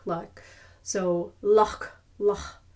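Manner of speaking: loch with a throaty ch; Scottish loch. Shown as a demonstration. Teacher drilling the Scottish loch. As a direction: say loch with the rasp at the end. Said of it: "more like the loch".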